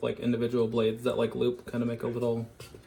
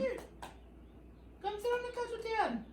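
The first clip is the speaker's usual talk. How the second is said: high soft coaxing; 8 dB quieter than usual. Real, not acted. Coaxing the dog softly.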